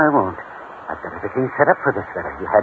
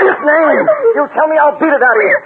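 angry shouting; 6 dB louder than usual. Acted. Jealous man beating her.

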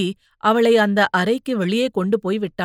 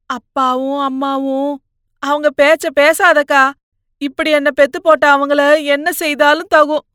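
louder, higher, resentful outburst; bitter angry brother; 5 semitones higher, 8 dB louder than usual. Acted. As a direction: spit out the grudge against the parents. Brother lashes out at parents. (பெற்றோர் மேல் கோபத்துடன் கத்தும் தம்பி)

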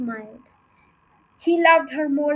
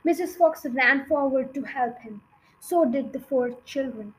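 ordinary delivery, nothing marked

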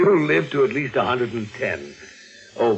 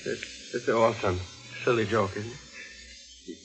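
hushed, breathless and scared, voice dropping away; frightened disbelief; 3 semitones lower, 9 dB quieter, slower than usual. Acted. Niece facing her aunt with the poker, frightened and hushed. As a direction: plead in a terrified near-whisper.

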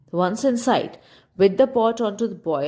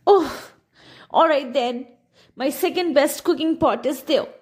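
snapped; 7 semitones higher than usual. A narrator's reading. Old woman grudgingly lets the traveller use her pot, snapping high. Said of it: "then snapped".

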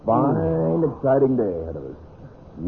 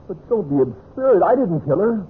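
panicked denial; 8 semitones higher than usual. Acted. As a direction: plead your innocence in panic. Accused of murdering Lydia.

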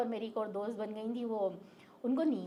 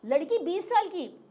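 ordinary delivery

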